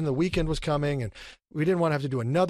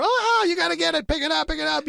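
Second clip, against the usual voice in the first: urging excitedly; 14 semitones higher than usual. Retold or imitated. Actor urging his co-star during a take, high excited urging. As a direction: hype your partner into the bit. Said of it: "so we were like".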